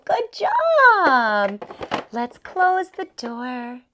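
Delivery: usual speaking voice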